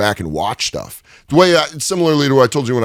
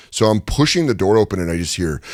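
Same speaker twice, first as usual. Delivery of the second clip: ordinary talk, nothing marked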